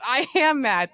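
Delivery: hammed-up mad mother; mock angry mom. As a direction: play the furious mom, half in fun. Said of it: "Daniel's mother gets, like, furious".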